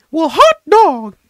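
high, slow cartoon mouse voice; Mickey Mouse. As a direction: stretch it out in a squeaky mouse voice. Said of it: "practicing my Mickey impression".

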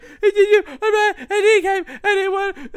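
very high, laughing squeaky voice; hysterical maid. Retold or imitated. Maid babbling to Van Helsing, mock hysterical squeak. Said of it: "the maid is hysterical".

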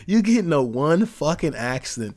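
usual speaking voice

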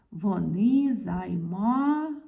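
very slow "займають", each vowel held; syllable-by-syllable. Shown as a demonstration. Teacher testing whether it ends in уть-ють, drawn-out syllables. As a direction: stretch ЗАЙМАЮТЬ out to its last syllable. Teacher sounds out verb ending.

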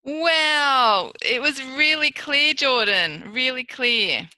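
loud, delighted praise; excited praise. Real, not acted. Therapist cheers Jordan's clear word, loud and delighted.

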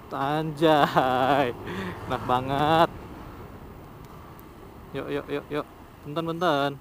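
ordinary talk

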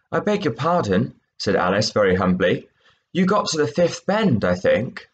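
lively read-aloud; story reading. A narrator's reading. Teacher reading the mouse and Alice scene, expressive. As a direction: voice the scene for the class. Teacher reads third extract.

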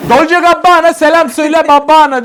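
street vendor call. Retold or imitated.

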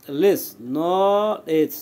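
লেস alone, then নলেজ stretched slowly as nooo-lej; broken into syllables. Shown as a demonstration. Teacher joining the spelled parts, syllable by syllable. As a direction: draw out the first part of নলেজ. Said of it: "এইভাবে ভেঙে ভেঙে".

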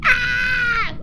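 high hammed-up yelp of fright; playful fake scream; mock scream. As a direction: shriek in fake terror.

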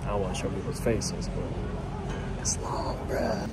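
whispered aside in a crowded line; whispering. Real, not acted. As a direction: hush it so nobody hears. In a quiet queue, not disturbing.

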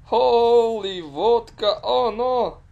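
high, loud, stretched outcry; shocked exclamation. Real, not acted. Player reacting to a mishap, loud shocked cry. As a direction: cry out slowly in loud disbelief.

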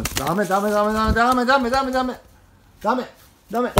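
rising alarm, stop it now; urgent no-no. Real, not acted.

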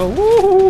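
very high, loud "hoo-hoo" whoop. Real, not acted. Player taunting enemies mid-fight, high loud whoop.

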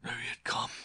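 strained, breathy gasp; words forced out on failing breath. A narrator's reading. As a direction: gasp it out, almost no voice. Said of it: "he gasped".

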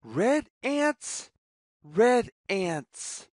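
ordinary delivery, nothing marked

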